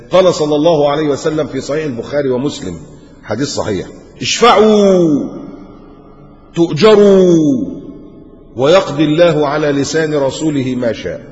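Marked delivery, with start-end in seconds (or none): 4.2-11.2 solemn hadith recitation. Acted. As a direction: intone the hadith slowly like scripture.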